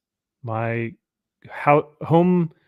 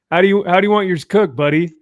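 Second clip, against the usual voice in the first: cheery grill dad; 6 semitones higher, 6 dB louder, faster than usual. Retold or imitated. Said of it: "and he's like".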